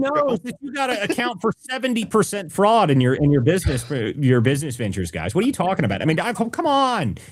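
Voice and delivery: high, smug mock official; sarcastic crook voice. Host mocks a corrupt official shrugging off fraud, high smug voice. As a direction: play the shifty official brushing it off.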